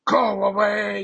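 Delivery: loud shouted go away; yelled. Retold or imitated. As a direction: yell her away. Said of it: "I just yelled at this poor girl".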